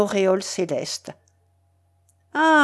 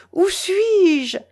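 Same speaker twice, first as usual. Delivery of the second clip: much higher anguished question; despairing cry; 10 semitones higher, 3 dB louder than usual. A narrator's reading. Mélanie collapsing in tears, crying out. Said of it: "en versant un torrent de larmes".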